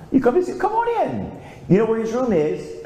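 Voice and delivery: high, bright welcoming mother; mother's greeting. Retold or imitated. Mom welcoming a late visitor, high and friendly. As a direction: greet him like a cheerful mom at the door.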